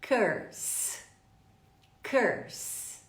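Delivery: "Curse" said carefully, vowel as in her; careful model pronunciation. Shown as a demonstration. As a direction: curse with a central er vowel and long hissed s. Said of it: "pronunciem comigo essa palavra corretamente".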